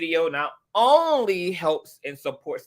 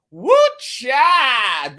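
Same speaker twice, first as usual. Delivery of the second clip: high, loud whoop of amazement; elated exclamation; 8 semitones higher, 8 dB louder than usual. Real, not acted. Host reacts to Lisa's big donation, elated high whoop. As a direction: burst out loud and high, stretching the words.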